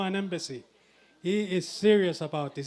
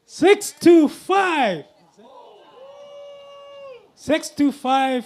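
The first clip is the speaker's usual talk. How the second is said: excited bid call; 12 semitones higher, 6 dB louder, much slower than usual. Real, not acted. Bid jumps during live auction.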